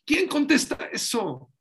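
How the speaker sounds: más agudo y fuerte; interpelación. Acted. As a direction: reta al público. Predicador interpela al público. (el rabino reta a la congregación, alzando la voz)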